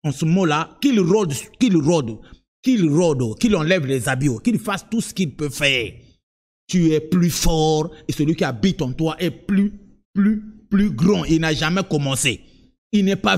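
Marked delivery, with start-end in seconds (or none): none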